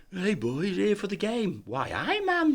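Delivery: high-pitched Geordie impression; very high mock Geordie banter; Geordie accent. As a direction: greet the pub in exaggerated, singsong Geordie. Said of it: "these two Geordies walk in".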